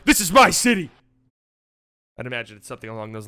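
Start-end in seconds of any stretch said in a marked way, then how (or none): 0.0-0.9 proud declaration. Retold or imitated. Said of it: "lets the bench know".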